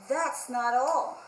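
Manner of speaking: ordinary delivery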